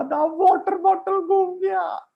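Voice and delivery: put-on high whiny child's wail; crying little girl. Girl telling her papa, high tearful whine. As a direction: whimper it like a sulking little girl. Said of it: "उसने कहा".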